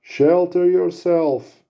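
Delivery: slow, high, loud yell of warning. Acted. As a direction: bellow the warning out, drawn long. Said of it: "he yells to the Phantom".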